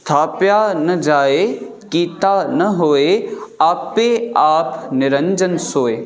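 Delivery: थापिया, कीता, आपे each followed by a pause, slow; slow recitation, pausing after थापिया and after कीता. Shown as a demonstration. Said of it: "विश्राम काथे काथे लॻनि था?". (विराम सां धीरे पढ़ो)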